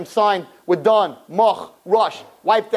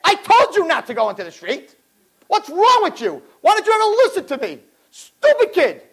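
scolding mother; 8 semitones higher than usual. Pretend. Scolding mother over bleeding child.

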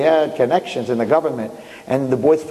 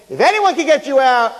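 boastful; 11 semitones higher, 4 dB louder than usual. Acted. Lawyer boasting to his friend.

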